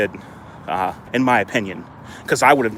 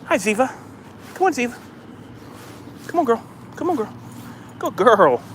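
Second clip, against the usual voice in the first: much higher, softer doggy talk; coaxing the dog; 12 semitones higher, 5 dB quieter than usual. Real, not acted. Owner coaxing his dog to come back to him, very high coaxing. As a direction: sweet-talk the dog over to you, way up high.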